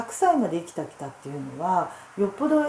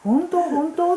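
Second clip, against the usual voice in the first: high, louder disbelieving questions; retold surprise; 11 semitones higher, 5 dB louder, much slower than usual. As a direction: re-enact a delighted double-take. Grandmother's reaction to grandson, retold. (驚いて聞き返す口真似)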